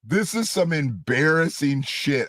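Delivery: ordinary talk, nothing marked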